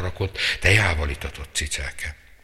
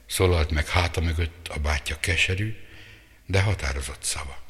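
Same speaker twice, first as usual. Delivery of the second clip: ordinary delivery, nothing marked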